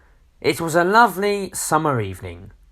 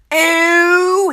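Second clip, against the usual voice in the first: long, very high, loud "ooh"; drawn-out cartoon squeal; gleeful squeal; 8 dB louder than usual. A narrator's reading. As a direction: squeal with mischievous delight. Mister Tickle grins at the plan.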